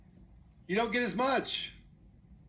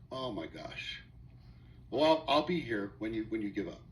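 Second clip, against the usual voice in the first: low, soft mock scorn; a teacher who expects you to fail; doubting teacher; 9 semitones lower, 4 dB quieter than usual. Teacher to the pupil, low and scornfully doubtful. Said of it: "You go to the teacher".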